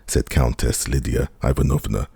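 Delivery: ordinary delivery, nothing marked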